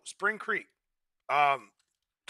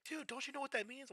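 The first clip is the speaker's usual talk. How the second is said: high, softer mocking friend voice; imitating a friend; 10 semitones higher, 10 dB quieter than usual. Imitating a friend explaining slang, high and mocking. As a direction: talk down like a know-it-all pal. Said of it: "they're like".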